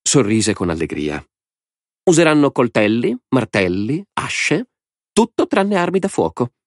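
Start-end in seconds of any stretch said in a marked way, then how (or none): 2.0-6.5 high, gleeful madman's voice; a mad lord savouring the game; Lord Alvin. A narrator's reading. (voce alta di signore folle e allegro)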